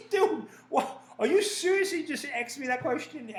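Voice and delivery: high, laughing disbelief; dude, seriously?; incredulous. Acted. Comic hearing a dumb interview question, incredulous laugh.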